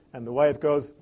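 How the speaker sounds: usual speaking voice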